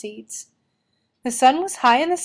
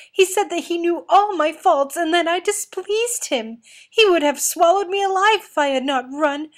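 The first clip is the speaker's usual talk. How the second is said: much higher, panicked warning; terrified sobbing; 7 semitones higher, 4 dB louder than usual. A narrator's reading. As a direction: gasp out the horror to your sister.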